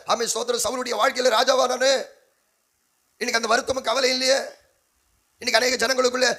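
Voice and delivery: high-pitched pulpit outcry over what is lost; impassioned preaching lament. Acted. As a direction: cry out each loss to the congregation.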